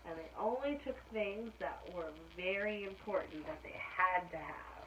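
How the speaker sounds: ordinary delivery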